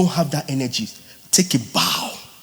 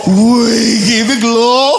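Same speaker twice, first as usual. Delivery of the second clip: mock singer; 6 semitones higher, 7 dB louder, much slower than usual. Mimicking singer's voice.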